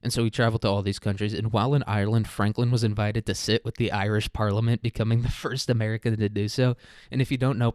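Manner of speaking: ordinary talk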